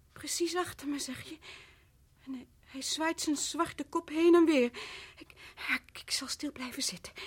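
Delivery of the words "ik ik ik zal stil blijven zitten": soft, trembling whispered promise; whispered terror; terrified whisper. Acted. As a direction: whisper it, afraid to move. Wife terrified of the snake.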